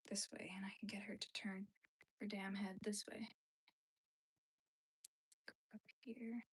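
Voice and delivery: muttered whisper to herself. Real, not acted. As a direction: whisper at the stubborn doll head. Whispering while sewing doll head.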